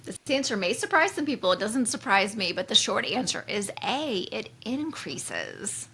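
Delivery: normal talking voice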